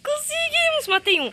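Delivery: high, loud cry of victory; elated cry. Real, not acted. Gamer crying out in joy after a kill. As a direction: celebrate the kill.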